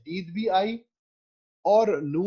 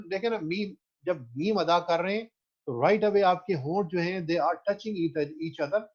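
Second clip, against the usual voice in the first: usual speaking voice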